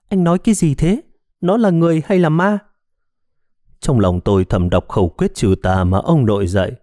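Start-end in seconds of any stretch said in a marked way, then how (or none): 0.1-2.6 trembling voice. A narrator's reading. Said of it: "run rẩy nói".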